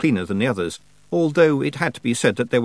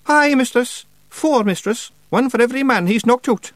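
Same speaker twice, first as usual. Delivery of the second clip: high, loud Scots pictsie; cheeky wee Feegle; 5 dB louder than usual. Acted. Feegle flatters the witch, cheeky.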